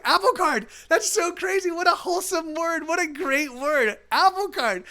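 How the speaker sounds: high giddy wonder; euphoric. Retold or imitated. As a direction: gush with tripping delight. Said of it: "I'm like".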